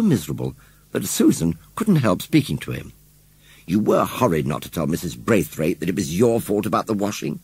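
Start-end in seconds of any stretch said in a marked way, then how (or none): none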